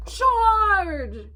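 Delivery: high, bold charge yell; rally the troops with one cry; yelled battle cry. Acted. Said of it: "yelled the mouse".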